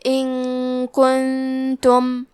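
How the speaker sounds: ان کنتم very slowly, the noon hidden in a long ghunna; ikhfa with ghunna. Shown as a demonstration. Tajweed teacher demonstrating ikhfa to students, slow recitation. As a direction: hum the n of ان کنتم before the k. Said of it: "یہ حکم کو غنہ کے ساتھ ادا کریں گے".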